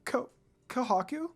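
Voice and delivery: barely believing it, near tears; a trembling hope; tearful disbelief. A narrator's reading. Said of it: "with tears forming in her eyes".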